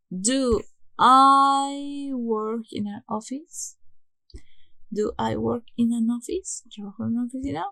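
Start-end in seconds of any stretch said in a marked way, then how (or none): 0.1-3.8 slow, louder, drawn-out model sentence; slow model question. Shown as a demonstration. Teacher modelling the question order for students, slow and deliberate. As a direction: spell out the question slowly for learners. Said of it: "el auxiliar, primero".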